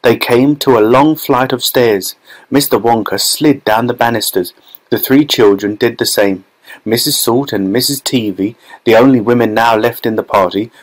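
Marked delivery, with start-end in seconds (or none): none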